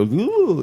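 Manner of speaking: high, drawn-out "ooh"; mock swooning ooh. Podcaster miming a victim whose spine is disconnected, mock swooning ooh. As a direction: let out a high, mocking little ooh.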